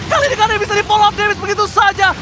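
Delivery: excited. Real, not acted. Caster hype on kill.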